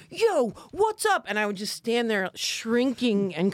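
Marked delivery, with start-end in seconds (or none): 0.1-1.2 much higher, slow tough-guy greeting; mock gangster. Classmates playing gang members, in a hammed-up tough voice. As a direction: ham up a fake street tough. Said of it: "everybody would immediately be like".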